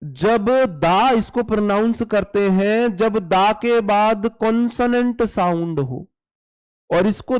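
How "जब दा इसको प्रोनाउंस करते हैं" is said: usual speaking voice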